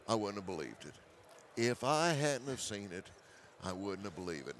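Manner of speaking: trailing off softly in disbelief; fading to quiet. Real, not acted. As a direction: let it fade, hushed and amazed. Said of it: "repeated, fading softer".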